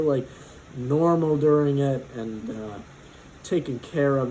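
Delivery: usual speaking voice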